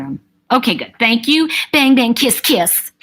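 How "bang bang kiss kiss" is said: playful spy catchphrase. Pretend.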